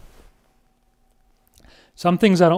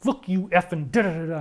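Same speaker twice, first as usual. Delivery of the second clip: mock vicious insult; 6 dB quieter than usual. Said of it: "was vicious".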